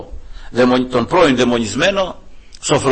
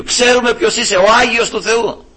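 higher, pleading demon voice; 7 semitones higher than usual. Retold or imitated. Preacher repeats the demons begging Christ. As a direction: beg as the demons once more. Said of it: "παρακαλούσανε τον Κύριο".

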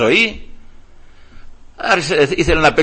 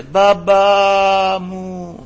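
long drawn-out high wail, imitated; child's cry; 5 semitones higher, much slower than usual. Child calling to father from the treatment room, imitated wail. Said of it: "μέσα το παιδί φώναζε".

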